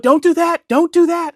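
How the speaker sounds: high, loud mock girlfriend's plea; imitated alarmed warning. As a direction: cry out a warning in her voice. Girlfriend warning him, acted out.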